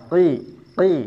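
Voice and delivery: "Ti" as qi, tongue root lifted; isti'la pada kasrah. Shown as a demonstration. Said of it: "mengangkat pangkal lidah". ("Ti" dengan pangkal lidah terangkat)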